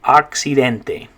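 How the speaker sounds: accidente slowly, ahk-see-DEN-teh; teacher models accidente; slow Spanish model word. Shown as a demonstration. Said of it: "in Spanish".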